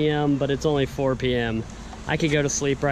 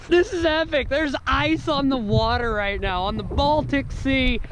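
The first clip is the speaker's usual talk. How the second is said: excited; 7 semitones higher than usual. Real, not acted. Just got into icy sea.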